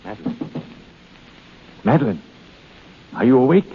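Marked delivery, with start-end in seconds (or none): 0.0-0.6 hushed. Acted. Trying to wake sleeper.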